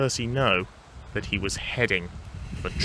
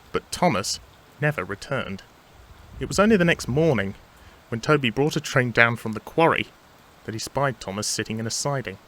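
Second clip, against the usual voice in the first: usual speaking voice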